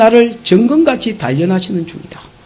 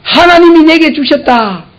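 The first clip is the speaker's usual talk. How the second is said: proclaimed; 7 semitones higher than usual. Shown as a demonstration. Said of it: "이 생각이 없으니까".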